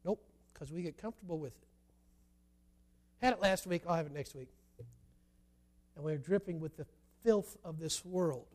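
normal talking voice

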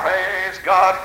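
ordinary talk

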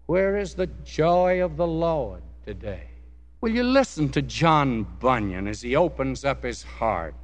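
ordinary talk, nothing marked

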